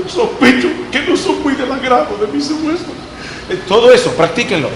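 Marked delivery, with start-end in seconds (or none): 0.1-2.9 high-pitched put-on weepy reading; mock crying. Shown as a demonstration. Speaking teacher shows students how to read it llorando, mock crying.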